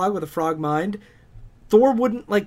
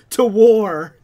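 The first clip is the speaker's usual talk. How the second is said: loud rallying yell; battle cry; 10 dB louder than usual. Retold or imitated. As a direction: rouse the crowd to war. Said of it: "all of Asgard was like".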